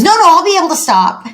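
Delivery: put-on high-pitched kid promising eagerly; insistent child. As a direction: insist like a child bargaining for time. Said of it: "And Jason says".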